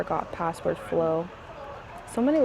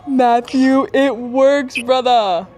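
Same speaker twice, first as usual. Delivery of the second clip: loud, excited call to a brother; elated announcement; 3 semitones higher, 7 dB louder, much slower than usual. Real, not acted. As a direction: shout the good news down the phone. Excitedly phones helper with success.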